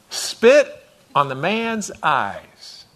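very slow, each word stretched out; slow emphasis. Shown as a demonstration. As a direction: drag out "Spit" for effect. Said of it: "slow emphasis on spit".

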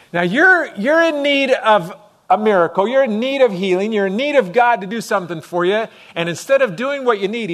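high and loud, building fervour; full-force preaching. Acted. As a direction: drive it home to the congregation.

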